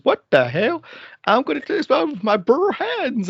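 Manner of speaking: high, hammed-up bad country accent; terrible accent. Farmer punching the metal tent, in a terrible put-on accent. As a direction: do the accent badly, pitched well up. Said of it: "oh, it's a terrible accent".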